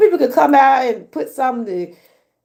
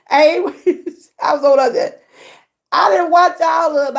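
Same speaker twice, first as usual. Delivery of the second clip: ordinary talk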